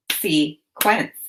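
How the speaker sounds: "Sequence" split into two syllables with a pause between; "Sequence" broken as se... quence; chopped syllables. Shown as a demonstration. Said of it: "Now chop the syllables in".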